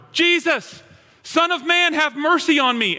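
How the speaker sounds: high, loud cry to Jesus. Retold or imitated. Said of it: "starts yelling even louder".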